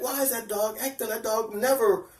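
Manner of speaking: high-pitched re-enacted puzzlement; retold amazement. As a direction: act out being amazed, pitch well up. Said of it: "now I'm amazed at the dog".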